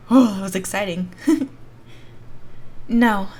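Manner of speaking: usual speaking voice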